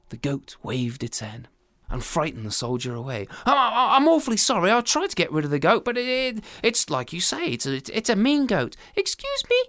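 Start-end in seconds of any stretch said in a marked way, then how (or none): 3.4-8.8 stammering, apologetic and higher; a sheepish husband making excuses; lightly voiced fluster. 8.9-9.6 very high, small ant voice; a tiny creature piping up; squeaky tiny voice; tiny little voice. Acted. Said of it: "said a tiny little voice".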